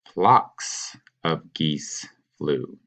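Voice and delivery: very slow, each word laid out; teacher modeling "Flocks" slowly and carefully; slow model answer. Shown as a demonstration. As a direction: spell the corrected sentence out slowly for students. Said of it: "You're gonna say".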